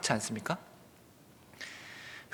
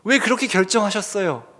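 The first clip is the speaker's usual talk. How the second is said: mild indignant protest, raised voice; louder, higher mock complaint; angry questioning; 5 semitones higher, 7 dB louder than usual. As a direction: imitate Jonah challenging God, higher and louder. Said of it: "화를 내고 있죠".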